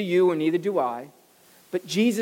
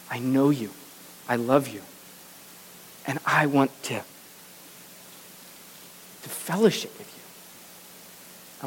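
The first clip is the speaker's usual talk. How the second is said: tender voice of Jesus; 6 semitones lower than usual. Acted. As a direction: lean in close and offer grace gently. Jesus voiced speaking tenderly to sinner.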